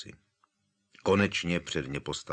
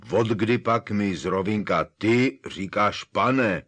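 louder, slow astonished giant; indignant Hagrid; 3 semitones higher, 7 dB louder, slower than usual. A narrator's reading. Hagrid demands why Harry calls him sir, loud. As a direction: Hagrid outraged at being called sir.

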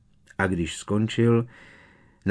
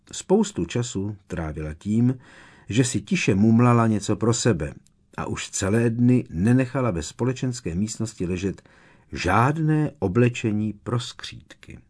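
ordinary delivery

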